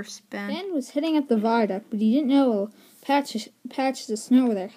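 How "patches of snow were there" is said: ordinary delivery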